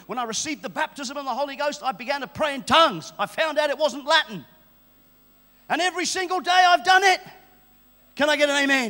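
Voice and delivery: loud, high testimony building to a shout; fervent preaching. Real, not acted. Preacher telling of praying in tongues, fervent rising shout. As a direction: drive it up toward an amen.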